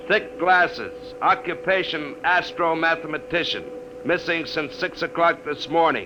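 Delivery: slow, measured on-air broadcast read; radio announcement. Acted. Charlie reads missing persons list over the air, announcer read.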